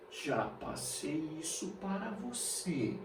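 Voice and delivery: very soft, slow aside; hushed reminder. Pretend. Teacher hinting to students they were taught this, hushed. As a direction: drop right down, as if slipping them a secret.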